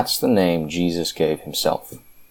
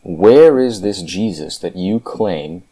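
usual speaking voice

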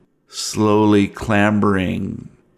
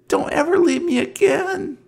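put-on high childlike plea, much higher; little girl voice; faster than usual. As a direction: plead like a small scared girl. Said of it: "And she says".

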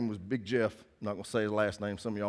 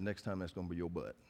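much softer, lower menacing warning; low warning in ear; 7 semitones lower, 11 dB quieter than usual. Retold or imitated. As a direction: lean in and threaten quietly. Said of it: "I leaned over in her ear".

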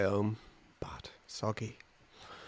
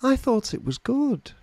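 high-pitched mimicked audience member; high voice of someone offering unasked reassurance; 12 semitones higher than usual. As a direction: imitate a well-meaning punter, pitched way up. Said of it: "People come up to me at the end and go".

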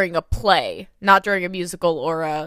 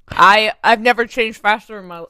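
talking round an ice cube, a bit muffled; words said past a cube of ice in the mouth; 5 dB louder than usual. Real, not acted. Telling the quick change story with an ice cube in her mouth. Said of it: "sorry, I have an ice cube in my mouth".

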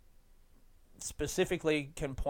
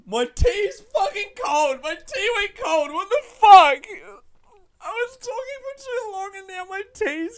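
much higher, louder, slow comic shout; hammed-up outrage; 11 dB louder than usual. Real, not acted. Comedian discovering his tea went cold, hammed-up higher shouting wail. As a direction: rage at the cold tea like a tragedy, half wailing.

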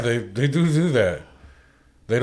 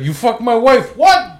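loud, high movie tough guy confrontation; heated acted two-man argument; Raging Bull quarrel; 11 semitones higher, 6 dB louder than usual. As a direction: accuse like a jealous husband, then shoot back loudly. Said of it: "you don't remember Raging Bull".

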